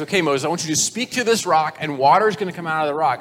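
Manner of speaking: a hint of a patient God; God instructing. God telling Moses to speak to the rock, instructing. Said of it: "And God goes".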